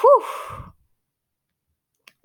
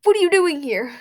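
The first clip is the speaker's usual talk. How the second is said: angry; 6 semitones higher, 6 dB louder than usual. Acted. Angry confrontation at the cafe.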